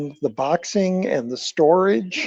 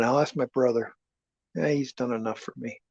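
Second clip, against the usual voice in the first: usual speaking voice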